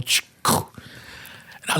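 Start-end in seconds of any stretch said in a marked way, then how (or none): 0.0-0.2 unvoiced-sounding door clunk imitation; imitated car door. Officer retelling the door opening behind him, imitated door noise. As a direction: mimic a car door popping open. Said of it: "I heard my door go".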